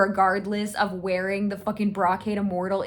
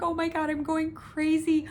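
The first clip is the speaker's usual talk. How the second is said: higher, frantic, worried voice; mock freaking out; 8 semitones higher, faster than usual. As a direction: gush it out in a panic. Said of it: "he's, like, freaking out".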